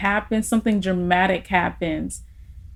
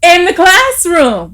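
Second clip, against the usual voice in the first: laughing admission; 9 semitones higher, 9 dB louder, slower than usual. Real, not acted.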